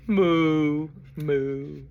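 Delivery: long, drawn-out mooing; cow moo. Acted. Reader showing children how Mister Brown goes moo, cow imitation. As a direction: moo like a cow for little listeners. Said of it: "He can sound like a cow".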